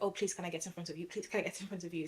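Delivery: rattled off fast and hushed, begging; hurried pleading. Retold or imitated. To people ahead in the queue, quick hushed pleading. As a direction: rush the plea under your breath.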